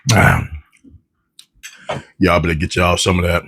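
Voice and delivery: ordinary delivery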